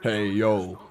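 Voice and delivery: low, gruff-voiced Razor Ramon try; Razor Ramon impression. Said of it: "Just a little Razor Ramon for ya".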